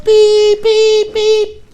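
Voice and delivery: high, loud beeps like a horn; imitated car horn. Driver honking at the kid in the street, high mock horn beeps. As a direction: honk at him with your voice.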